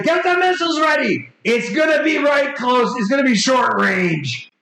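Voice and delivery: high-pitched shouted call to the crew; shouted order. Acted. Pilot shouting to the crew to ready missiles, urgent high shout. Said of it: "Shouting over your shoulder, calling".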